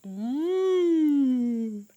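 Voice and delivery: plain reading voice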